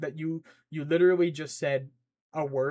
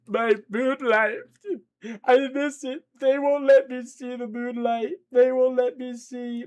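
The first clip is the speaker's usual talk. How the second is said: high, loud sniveling wail; hammed-up sobbing role-play; whimpering crying guy; 8 semitones higher, 6 dB louder than usual. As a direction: blubber pitifully, high and loud. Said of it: "this guy's just crying. He's like".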